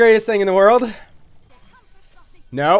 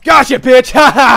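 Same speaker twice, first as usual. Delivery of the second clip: gleeful yell, higher and louder; triumphant shout; 6 semitones higher, 9 dB louder than usual. Real, not acted. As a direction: crow over the kill. Triumphant kill in game.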